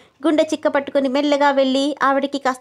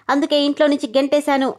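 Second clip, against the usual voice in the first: ordinary delivery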